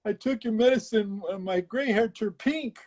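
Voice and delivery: high, whiny lady voice; playful imitation of a townswoman; mock complaining lady. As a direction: complain like an upset customer. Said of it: "this lady".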